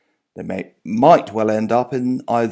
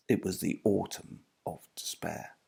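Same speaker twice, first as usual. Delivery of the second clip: hushed whisper; 4 semitones lower, 11 dB quieter than usual. Acted. Narrator closing the Dickens quote in a whisper. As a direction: drop to a breathy whisper on the dark line.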